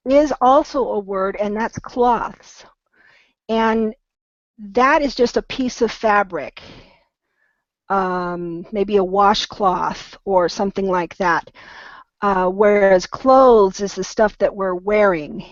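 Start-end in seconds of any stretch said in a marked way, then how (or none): 1.8-2.6 "cloths" with a short open aw vowel and crisp th. Shown as a demonstration. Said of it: "difference is going to be in how you pronounce". 13.1-13.8 "clothes" with a long oh vowel, as in go. Shown as a demonstration. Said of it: "a long O sound".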